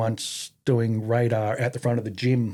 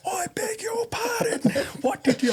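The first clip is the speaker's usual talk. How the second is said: much higher, slow, prim indignant gasp; outraged matron. Retold or imitated. Imitating the outraged female RSM hearing the insult. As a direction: squeak out her shocked indignation like a scandalised matron.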